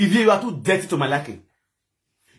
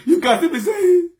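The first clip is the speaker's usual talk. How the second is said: higher-pitched, incredulous mockery; scornful lead-in; 10 semitones higher, 4 dB louder than usual. Real, not acted. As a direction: sneer it upward before imitating them. Leads into mocked live-video wailing.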